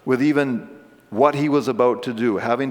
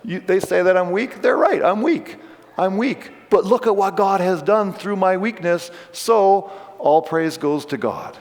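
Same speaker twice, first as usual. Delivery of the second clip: higher, rising with bold confidence; a touch of triumph, higher; triumphant Paul; 6 semitones higher than usual. Retold or imitated. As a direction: own the weakness with glee. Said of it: "So he's like".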